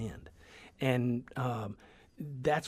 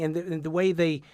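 ordinary talk

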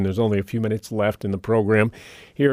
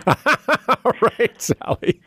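laughing; 13 semitones higher, much slower than usual. Real, not acted.

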